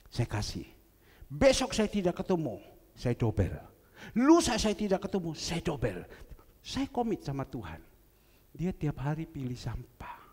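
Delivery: normal talking voice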